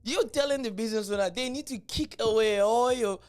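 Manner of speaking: high and loud, amused disbelief; can't contain the amusement; excited disbelief. Real, not acted. Excited retelling of clip.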